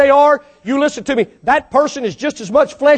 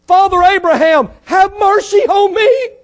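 crying plea; 7 semitones higher, 4 dB louder than usual. Retold or imitated. Preacher acting the rich man crying to Abraham. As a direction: wail it out, begging. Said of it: "You're depending on men".

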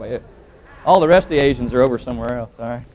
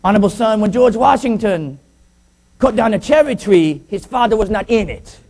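higher-pitched father in put-on Chinese accent; mock Chinese accent; 7 semitones higher, 3 dB louder than usual. Father answering his son, mock Chinese accent. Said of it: "He said".

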